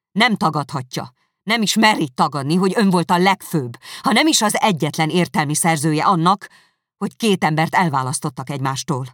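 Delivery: loud, indignant accusing voice; angry accusation. Acted. Elizabeth accusing Darcy to his face, angry and loud. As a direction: hurl the charges at him.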